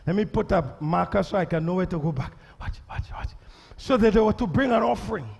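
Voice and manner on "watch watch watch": hushed whisper. Real, not acted. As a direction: drop to a whisper. Preacher hushes crowd.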